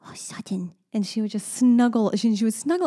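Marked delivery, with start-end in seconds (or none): 0.1-0.7 put-on soft breathy whisper of a child's line; whispered toddler quote. Mother imitating daughter snuggling the statue, whispered. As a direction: hush it like a little girl copying a movie. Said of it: "so she kept saying".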